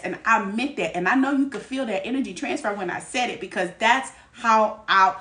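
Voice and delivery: ordinary talk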